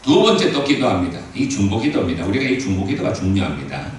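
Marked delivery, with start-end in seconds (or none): none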